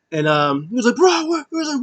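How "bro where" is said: very high, loud calling out; hammed-up high-pitched shout; mock frantic call. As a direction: act out calling for someone in a panic. Acts out frantic searching.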